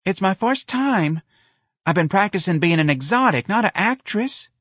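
high, slightly whiny excuse; pleading. A narrator's reading. Darlene excusing her fluffed line to Lana, pleading. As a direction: plead your inexperience in a high voice. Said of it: "Darlene pleaded".

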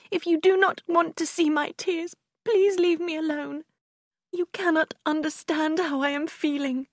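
high-pitched, distraught protest; crying. A narrator's reading. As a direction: push her away in tearful distress. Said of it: "Marianne began to cry".

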